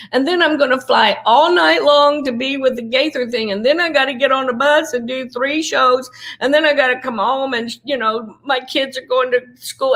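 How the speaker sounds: half-hearted imitation of her overwhelmed, weepy self; retold tearful complaining. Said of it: "I remember crying and saying".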